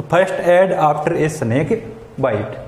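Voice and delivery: very slow, word by word; slow dictation. Shown as a demonstration. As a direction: read the title slowly for the class. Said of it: "reading the topic slowly". (धीरे-धीरे शीर्षक पढ़ना)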